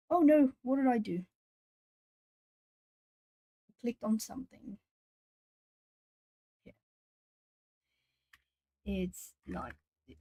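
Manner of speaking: ordinary talk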